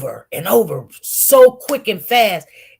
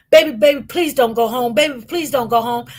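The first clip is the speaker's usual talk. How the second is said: imitating begging mother; 4 semitones higher than usual. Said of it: "my mother be on the phone begging and crying".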